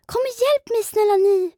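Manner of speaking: high, loud, panicked plea; desperate cry for help. A narrator's reading. Sinking Pindel begs the striders for help, high panicked cry. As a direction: scream for help while sinking. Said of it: "Han skrek i högan sky".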